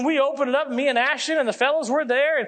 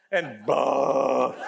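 imitated retching; 9 semitones lower than usual. Friend getting sick on the ride.

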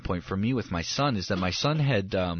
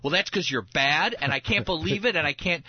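mock scolding; 6 semitones higher than usual. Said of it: "right away I wanted to be like".

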